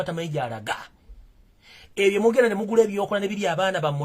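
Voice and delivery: loud, high preaching voice. Acted. Preacher rousing listeners against buying magic luck, fervent and loud.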